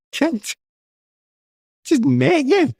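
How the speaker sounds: high, laughing reply to the burglar; laughing disbelief. Retold or imitated. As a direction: giggle it out in amused disbelief. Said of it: "I went".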